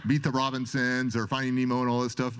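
normal talking voice

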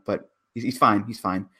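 ordinary talk, nothing marked

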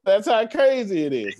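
high, loud laughing through the words; giddy laughter; laughing hard. Real, not acted. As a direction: laugh it out, amazed. Laughing at crazy numbers.

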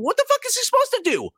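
very high, fast mock outrage; mocking squeal. Host mocking angry fans in a high squeaky voice. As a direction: squeal it like an indignant fan.

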